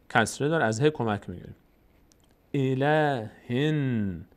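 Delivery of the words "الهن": very slow recitation of الهن with the final n held; joined syllables. Shown as a demonstration. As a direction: draw out الهن, humming on the joined nun. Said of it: "اون هم میچسبه به".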